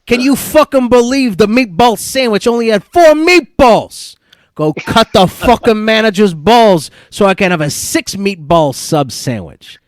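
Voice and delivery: hammed-up Italian-American mobster rant; Italian accent. Host reads a quiz line about meatballs, irate Italian accent. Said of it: "Use my best Italian accent".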